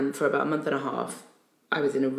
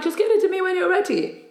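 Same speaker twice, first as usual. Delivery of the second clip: high mock voice; 4 dB louder than usual. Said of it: "If you're like".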